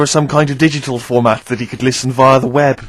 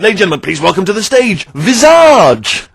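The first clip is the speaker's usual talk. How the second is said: arena announcer; 6 semitones higher, 4 dB louder than usual. Retold or imitated. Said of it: "you can't imagine someone saying".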